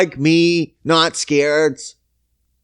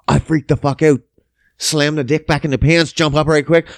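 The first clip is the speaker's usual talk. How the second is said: ordinary delivery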